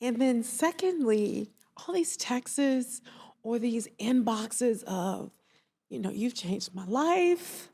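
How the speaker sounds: real emotion welling up; getting emotional. Real, not acted. Therapist tears up recalling clients' thanks. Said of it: "oh, I'm getting emotional".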